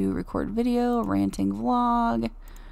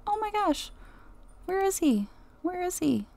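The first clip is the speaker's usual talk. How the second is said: higher, worried searching; anxious searching; 8 semitones higher, 4 dB quieter than usual. Real, not acted. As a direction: fret while looking around.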